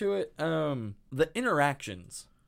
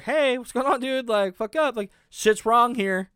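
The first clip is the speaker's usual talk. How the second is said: higher, louder panicky tone, lightly voiced; mock alarmed girl; 7 semitones higher, 5 dB louder than usual. Host imitates the heroine sensing danger, hammed-up alarm. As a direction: play her sudden worry, hammed-up. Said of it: "she's like".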